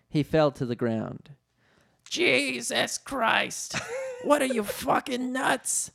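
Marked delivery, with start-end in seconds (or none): 2.1-5.9 high, drawn-out outraged yell; comic shouting in a high put-on voice; Danny DeVito. A narrator's reading. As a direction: play an outraged little man, stretching words. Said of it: "shouted the man".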